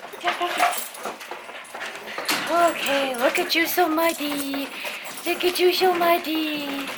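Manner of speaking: high, sing-song voice for the dogs; pet talk. Real, not acted. Owner greeting her muddy dogs at the door, high doting pet voice. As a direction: coo at the muddy dogs like babies.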